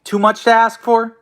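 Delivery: ordinary talk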